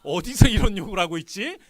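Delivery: king's indignant thought. Acted. King privately reacting to cupbearer's request, indignant. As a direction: play a king affronted by an underling. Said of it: "속으로 그랬을 거야".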